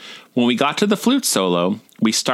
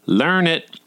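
higher-pitched catty southern drawl; 6 semitones higher than usual. Acted. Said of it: "softly in his cattiest southern drawl".

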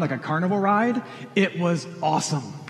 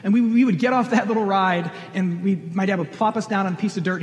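normal talking voice

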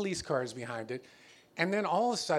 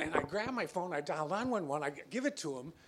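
ordinary talk, nothing marked

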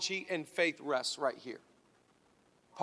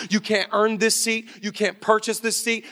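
preaching at full force; 3 semitones higher, 6 dB louder than usual. Acted.

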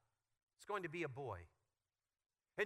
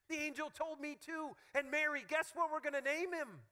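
excited Joseph; 8 semitones higher than usual. Retold or imitated.

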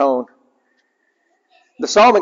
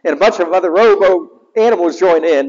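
usual speaking voice

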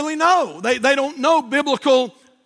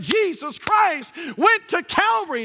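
high, slow, ringing pulpit proclamation; preacher proclaiming; 4 semitones higher than usual. Retold or imitated. Lady retells her first Sunday's sermon, imitated preacher's proclamation. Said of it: "the preacher got up and said this".

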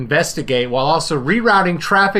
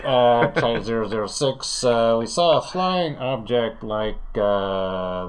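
low, halting pilot on the radio; airline pilot; 4 semitones lower, 4 dB quieter than usual. Acted. Pilot answers the tower's request, low radio-pilot voice. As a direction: report in as a pilot, voice dropped. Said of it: "as the China Airlines zero zero six crew".

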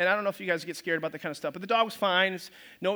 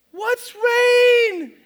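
high, loud baffled shout; baffled Noah; 14 semitones higher, 9 dB louder than usual. Acted. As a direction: cry out in total confusion. Noah voiced puzzled by God.

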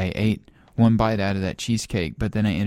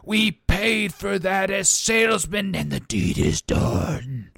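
ominous pronouncement; 7 semitones higher than usual. Acted.

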